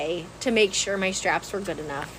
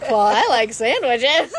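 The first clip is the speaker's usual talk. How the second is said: laughing; 6 semitones higher, 5 dB louder, slower than usual. Retold or imitated. Officer's reply retold, laughing.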